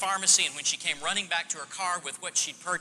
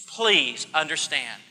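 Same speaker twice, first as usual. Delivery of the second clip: ordinary talk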